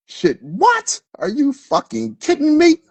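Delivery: can't believe what she's hearing; outraged disbelief. Real, not acted. Ranting to viewers about a snubbing woman, incredulous high outrage.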